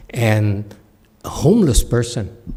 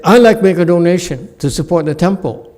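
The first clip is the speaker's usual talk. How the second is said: higher, louder and fast, self-assured; confident rich donor; 7 semitones higher, 7 dB louder, faster than usual. Acted. Rich merchant announcing his donation to the abbot, loud and brisk. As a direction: announce your gift so everyone hears.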